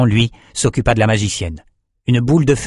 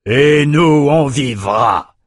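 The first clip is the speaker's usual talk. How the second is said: lâché froidement; 4 semitones higher, 4 dB louder, much slower than usual. A narrator's reading. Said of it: "lâcha Enoch".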